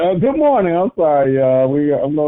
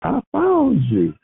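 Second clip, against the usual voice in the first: put-on 1 child's voice; 13 semitones higher, slower than usual.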